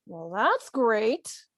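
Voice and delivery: slow, with "great" drawn out; sarcastic stress on great. Shown as a demonstration. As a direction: lean sarcastically on the word "great". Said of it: "they've put stress on the word great".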